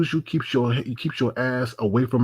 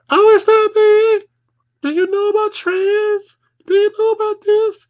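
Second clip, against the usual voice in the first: mocking squeaky voice; 6 dB louder than usual. Streamer mocking a disrespectful caller's squeaky voice with a high mocking squeak. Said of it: "come up here with that squeaky ass voice".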